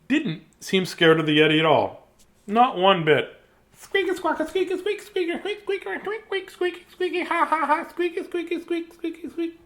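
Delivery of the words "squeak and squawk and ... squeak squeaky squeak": very high squeaky chirping babble; bird chatter. Acted. Bird chatters happily at the Yeti in squeaks. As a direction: chatter away like a fearless little bird.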